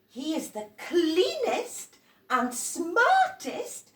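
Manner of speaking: high, loud, slow amazed praise; admiring teacher. Acted. Teacher presenting clean Eduardo to the children, high amazed praise. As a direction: show him off to the class.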